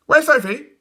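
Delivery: put-on high eager boys; eager twins. A narrator's reading. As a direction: ask eagerly. Twins ask.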